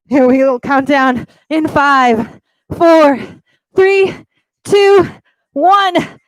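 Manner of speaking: high, loud pumped countdown; energetic countdown. Real, not acted. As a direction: count them home with energy. Coach counting down the plank.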